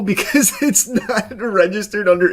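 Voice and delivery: laughing while talking. Real, not acted. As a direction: chuckle through the words. Laughs at account mystery.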